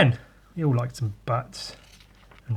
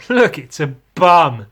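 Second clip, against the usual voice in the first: usual speaking voice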